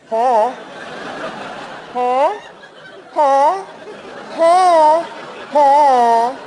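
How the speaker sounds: repeated high, loud come-on huh; insistent high mock come-on; street-hooker huh. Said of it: "like a street hooker".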